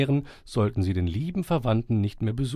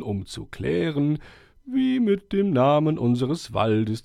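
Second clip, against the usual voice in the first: put-on higher, solemn old owl voice; 8 semitones higher than usual. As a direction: speak like a wise old owl addressing the forest. Owl Konrad's speech voice.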